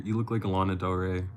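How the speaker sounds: ordinary delivery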